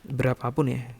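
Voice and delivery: ordinary talk, nothing marked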